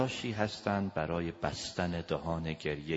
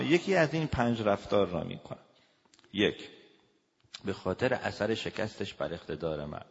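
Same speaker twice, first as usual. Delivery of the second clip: ordinary delivery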